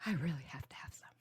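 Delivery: soft guilty whisper of temptation; whispered craving. Retold or imitated. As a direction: whisper it like a secret confession. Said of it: "But I was like".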